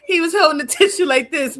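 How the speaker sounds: usual speaking voice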